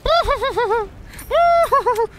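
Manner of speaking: high-pitched laughter in two bursts. Real, not acted. Player reacting to the game, high giggling laugh. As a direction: let out high, wobbling laughs.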